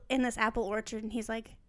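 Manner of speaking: normal talking voice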